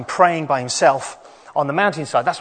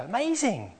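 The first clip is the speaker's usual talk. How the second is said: put-on lively higher children's storyteller; kids show host; 5 semitones higher than usual. Children's talk, higher animated voice.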